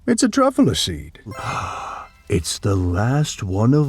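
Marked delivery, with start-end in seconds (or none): 1.3-2.0 soft gasp. A narrator's reading. Last seed revealed.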